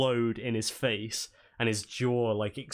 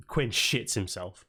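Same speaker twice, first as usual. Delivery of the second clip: ordinary delivery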